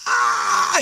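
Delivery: loud re-enacted scream of terror; nonstop screaming. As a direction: keep screaming in panic. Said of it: "I just kept screaming".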